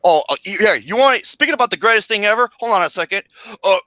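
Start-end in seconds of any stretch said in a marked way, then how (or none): none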